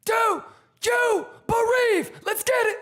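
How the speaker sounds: very high shouted clown chant; silly clown. Acted. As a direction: hype the chat like a cartoon clown.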